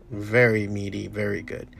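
ordinary talk, nothing marked

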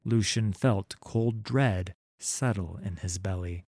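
ordinary delivery

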